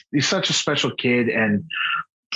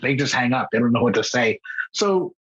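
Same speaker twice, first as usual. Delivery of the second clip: ordinary talk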